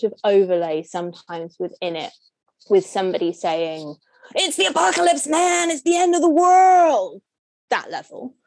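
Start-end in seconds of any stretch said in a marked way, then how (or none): 4.3-7.2 put-on high, loud Hollywood American accent, slowing on the end; over-the-top American accent shouting that the world is ending. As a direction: belt it out like an American disaster-movie character. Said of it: "that was a great American accent".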